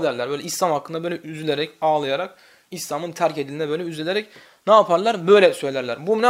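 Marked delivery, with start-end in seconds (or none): none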